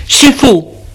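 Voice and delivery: high protesting yell; furious high denial; son yelling. Acted. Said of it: "Guillaume lui crie".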